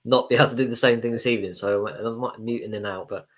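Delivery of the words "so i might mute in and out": ordinary talk, nothing marked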